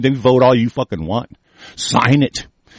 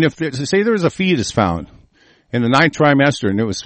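ordinary talk, nothing marked

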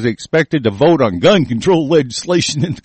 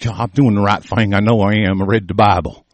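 lower, smug churchgoer imitation; mock pious voice; 6 semitones lower than usual. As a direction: mimic a self-righteous believer. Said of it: "just so you".

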